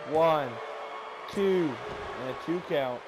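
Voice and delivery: excited count of a pinfall; wrestling commentator. Acted. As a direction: call the count live, building. Live pin count, play-by-play.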